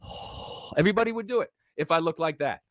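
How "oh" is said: soft voiceless gasp of awe; a hushed mock sigh of wonder; breathy awed oh. Shown as a demonstration. Fans reacting to the guru's wisdom with a mock awed gasp. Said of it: "That would be the sound you'd have to make".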